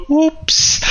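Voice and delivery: a high, coy feigned oops; fake innocent whoops.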